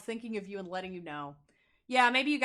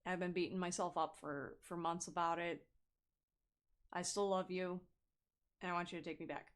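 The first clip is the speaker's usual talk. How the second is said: soft apology call; 4 semitones lower, 8 dB quieter than usual. Retold or imitated. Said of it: "you're like".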